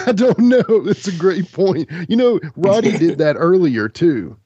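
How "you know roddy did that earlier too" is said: ordinary talk, nothing marked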